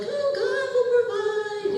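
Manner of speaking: mock husband. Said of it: "And Rocky's like".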